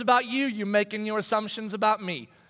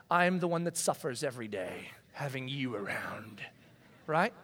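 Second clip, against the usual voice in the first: whispered gripe; 9 semitones lower, 7 dB quieter than usual. Retold or imitated. Sibling griping under breath.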